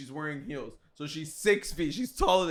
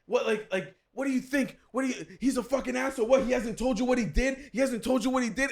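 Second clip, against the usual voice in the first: imitated angry yelling; 8 semitones higher than usual. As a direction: imitate someone heatedly telling her off. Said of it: "yelling and, and, and, like".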